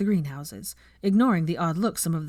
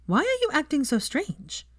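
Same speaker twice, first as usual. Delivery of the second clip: hotly; 10 semitones higher than usual. A narrator's reading. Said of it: "she said hotly".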